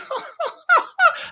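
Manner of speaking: high-pitched derisive laugh; scornful laugh. Real, not acted. Scoffing at being well liked.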